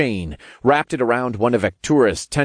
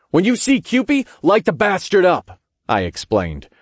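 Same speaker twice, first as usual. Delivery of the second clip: higher-pitched battle instruction; called-out order; 8 semitones higher than usual. A narrator's reading. Narrator calls instructions to Jane on the skyscraper, called-out order. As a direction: call it out urgently to a partner.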